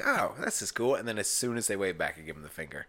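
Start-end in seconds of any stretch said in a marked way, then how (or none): 0.0-1.0 higher, mock cheery commuter. Commuters waving back at the passenger, mock cheery. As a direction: chirp it like a delighted driver waving back. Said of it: "cheery and".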